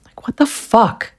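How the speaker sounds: exasperated, slow whispered swear. Real, not acted. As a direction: breathe it out under your breath, frustrated. Frustrated aside after ruined nail.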